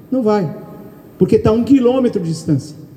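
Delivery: ordinary talk, nothing marked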